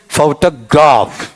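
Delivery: फोटोग्राफ slowly as "fau-ta-GAAF", falling; फोटोग्राफ with a long, stressed ending; fourth variant. Shown as a demonstration. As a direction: drag out the last syllable of फोटोग्राफ. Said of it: "मैं चारों बोल देता हूं".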